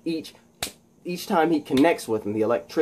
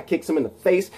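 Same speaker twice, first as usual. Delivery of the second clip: plain reading voice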